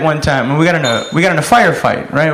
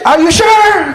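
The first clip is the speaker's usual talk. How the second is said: high, hollered query back; yelled doubtful question; 13 semitones higher, 4 dB louder, slower than usual. Acted. Sergeant in cover questioning the grenade order, yelled. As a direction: call it back loudly, voice way up. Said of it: "I was like".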